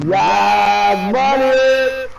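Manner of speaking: loud, high triumphant whoop; excited cheer. Real, not acted. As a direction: cheer the kill at the top of your voice. Celebrating a kill.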